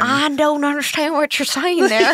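high Southern US twang; Southern accent. Shown as a demonstration. Partner showing off to prove it, Southern accent. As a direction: put on a Southern American accent. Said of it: "I can do Southern accents really well".

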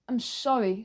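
ordinary delivery, nothing marked

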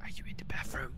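hushed, voiceless whisper. Real, not acted. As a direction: whisper the question very softly. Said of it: "You don't need to whisper to it".

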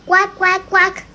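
duck quack. Shown as a demonstration. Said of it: "Do like".

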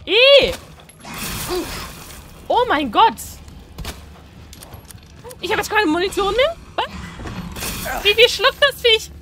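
high, loud startled yelps as the ammo runs out; panicked shrieks. Real, not acted. Scared gamer yelling at the monster that won't die, panicked shrieks. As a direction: shriek in real panic, voice jumping up.

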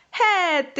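high, delighted little cry; excited exclamation. Acted. Mouse seeing hats behind the curtain, excited exclamation. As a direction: call it out on spotting the thing. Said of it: "esclamò la topolina".

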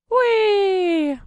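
drawn-out high "whee"; long squeal. Real, not acted. Player tumbling in the game, long squeal. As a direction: squeal and hold it.

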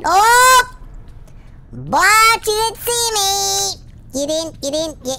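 high, slow taunting cry; excited gloating. Real, not acted. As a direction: tease the guard who missed you. Gamer caught by guard, gloating.

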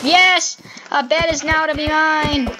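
loud cry of triumph over the loot; loud triumphant cheer. Real, not acted. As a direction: shout it out, finally winning. Finally got the wool.

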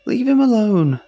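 very high loud desperate cry; desperate shout. Acted. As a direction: scream to save your friend. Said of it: "I cried".

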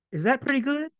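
high, hopeful question; unsure question. Acted. Boy asking his father, high and hopeful. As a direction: ask it hoping the answer is yes.

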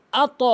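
আত with a bouncing stop; firm, rebounding আত; qalqalah. Shown as a demonstration. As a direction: push the consonant of আত so it echoes. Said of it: "এখানে কলকলা".

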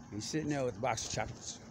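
usual speaking voice

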